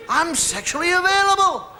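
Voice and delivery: teasing the audience with a sly offer; playfully flirty declaration; mock seductive. Comic mock come-on line.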